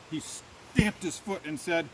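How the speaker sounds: plain reading voice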